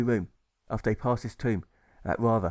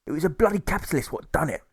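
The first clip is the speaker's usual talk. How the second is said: higher, louder cockney-ish grumble; socialist voice; 7 semitones higher, 3 dB louder than usual. Acted. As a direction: spit it out like an angry workman. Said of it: "the socialist starts to speak".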